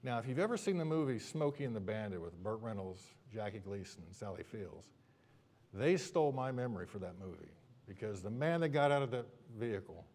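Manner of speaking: ordinary delivery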